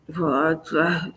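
ordinary delivery, nothing marked

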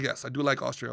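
low, soft imitated Austrian reply; understated Austrian accent; matter-of-fact Austrian. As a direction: lightly voice a deadpan Austrian. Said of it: "she was just matter of fact about everything".

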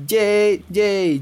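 ordinary talk

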